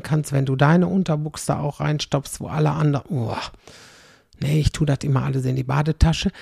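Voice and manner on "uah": low, soft "uah" of revulsion; shuddering yuck noise; disgusted groan. Real, not acted. Disgust at others' dirty underwear.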